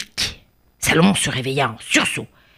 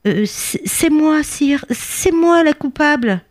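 high, hesitant small-creature voice; timid little bee; 10 semitones higher than usual. Acted. Tiny bee owning up to the king, timid confession.

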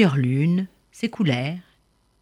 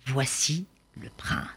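breathy storyteller hush; hushed whisper; 3 semitones lower, 7 dB quieter than usual. A narrator's reading. Narrator announcing the prince's arrival to children, in a soft whisper.

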